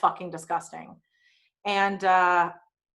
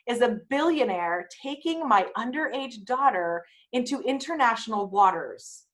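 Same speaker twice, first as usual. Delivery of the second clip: slow, louder stern dad; firm dad veto; 4 semitones higher, 4 dB louder, slower than usual. Retold or imitated. Dad refusing the billionaire's trip, slow and stern. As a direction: lay it down like a protective father. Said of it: "And then my dad goes".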